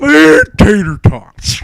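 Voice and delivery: slow, drawn-out character line; hammed-up hungry voice; mock greedy character. Friend reading fanfic aloud, slow mock character voice.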